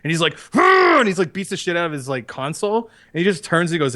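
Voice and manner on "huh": sharp high yelled huh; mock angry outburst; angry grunt. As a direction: let out a furious yelp. Kylo's angry outburst acted.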